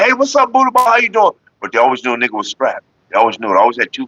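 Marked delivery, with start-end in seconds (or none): 0.0-1.3 high-pitched, eager hello; imitated friendly greeting. Townspeople greeting the man everybody respected, high imitated hello. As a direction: pitch it way up as an admirer saying hi.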